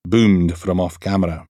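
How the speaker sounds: plain reading voice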